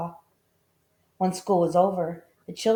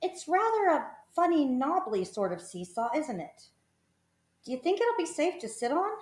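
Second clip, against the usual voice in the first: put-on doubtful older girl; 9 semitones higher, 4 dB quieter than usual. A narrator's reading. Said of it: "a girl who was older".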